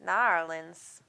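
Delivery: "Narlins" said as one clipped word, NAR-lins, swallowing the New; local pronunciation. Shown as a demonstration. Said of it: "New Orleans".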